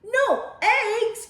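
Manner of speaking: high, loud shocked question; outraged cry. Acted. Farmer Brown in the background, high shocked cry. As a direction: shriek it in disbelief. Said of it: "cried Farmer Brown".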